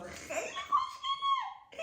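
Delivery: very high, slow, squealing with laugh and sob; laugh-crying delight. Real, not acted. Vlogger gushing at her makeup in the mirror, laugh-crying delight. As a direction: melt over how pretty it is. Overwhelmed by how pretty it looks.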